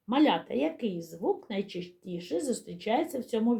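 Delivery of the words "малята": ordinary talk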